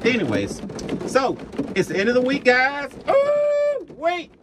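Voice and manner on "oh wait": very high squeak of surprise; sudden realization. Real, not acted. Trucker suddenly remembering the weekly recap, high surprised. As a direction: jump as you remember.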